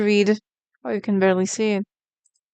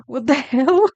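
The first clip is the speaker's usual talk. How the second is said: higher and louder, laughing through it; laughing disbelief; 5 semitones higher, 4 dB louder, slower than usual. Real, not acted. Streamer laughing at a viewer's perfect guess, delighted disbelief.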